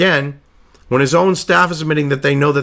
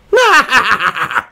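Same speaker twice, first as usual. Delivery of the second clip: loud, high scornful cackle; high mock laugh falling away; 6 semitones higher, 4 dB louder than usual. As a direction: burst into a derisive laugh. Scoffing at candidate's chances.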